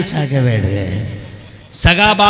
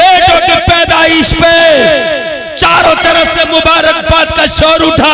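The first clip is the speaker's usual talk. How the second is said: high, loud preacher's shout; full-force high-pitched declamation; clamorous proclamation; 10 semitones higher, 6 dB louder than usual. Acted. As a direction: shout it out like a crowd's congratulations. Clamour for sons contrasted with silence.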